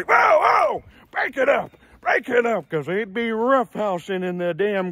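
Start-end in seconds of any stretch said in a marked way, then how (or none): 0.0-2.7 shouting to break it up. Retold or imitated. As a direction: yell at the boys to break it up. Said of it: "These are the nephews I had to say".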